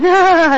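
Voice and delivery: real high, loud burst of laughter; sudden laugh. Real, not acted. Asked about her cousin Andrew Card, a loud startled laugh. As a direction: caught off guard, laugh out loud. Cousin question gets surprised reaction.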